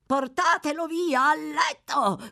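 high, slow frantic shout. Acted. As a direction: yell the order in delirium. (urlo acuto e lento)

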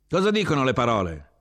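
high, loud yell, falling at the end; shouted question. A narrator's reading. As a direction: yell a question over the racket. Officer asks over the din.

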